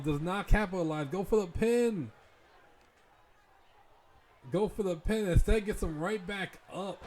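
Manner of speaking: excited live play-by-play; wrestling commentator. Acted. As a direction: call the match as it happens.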